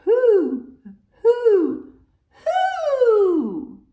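loud, high owl hoots, last one drawn out; big falling owl calls; owl hooting. A narrator's reading. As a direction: hoot like an owl, high and full. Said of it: "an owl says".

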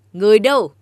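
king shouting for servants, loud; calling out. Acted. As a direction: call the guards sharply across the hall. King calls for his servants.

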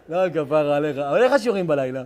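normal talking voice

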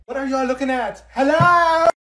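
high, loud shout. Acted. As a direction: yell at the eavesdroppers. Yelling at eavesdroppers.

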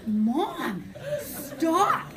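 loud protest leaping high on the last word; mock exasperated plea. Mortified daughter to drugged mom, loud exasperated plea. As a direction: imitate a mortified daughter, half laughing.

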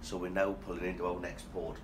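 low, soft, lightly seductive murmur; a hint of a sultry narrator; sexy travel voice. Shown as a demonstration. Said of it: "this is my sexy travel voice".

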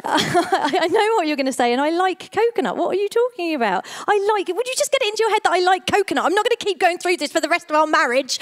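exasperated, high-pitched tirade; fed-up outburst, acted out in full flow; worked-up rant. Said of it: "all right, calm down".

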